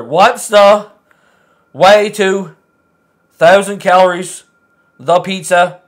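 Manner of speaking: loud chunks with gaps between; halting word-skipping reading. Shown as a demonstration. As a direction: read haltingly, working out what to skip. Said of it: "leave out every third word".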